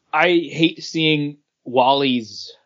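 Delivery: ordinary talk, nothing marked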